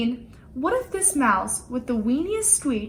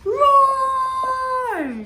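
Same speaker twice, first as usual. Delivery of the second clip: a huge, high-pitched shouted roar; mighty roar; 14 semitones higher, 4 dB louder than usual. A narrator's reading. Lion showing off to everyone, roaring loud. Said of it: "how loud he could roar".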